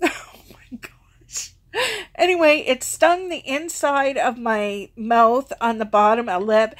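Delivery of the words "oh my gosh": wheezing laugh under the breath; breathless laugh. Real, not acted. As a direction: laugh out the words, nearly no voice. Laughing at eating a bug.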